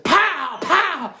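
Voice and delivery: high, loud shouted pow; loud mock pow. Teller shouts the leprechaun's thrust as a comic sound. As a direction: punch out the sound effect.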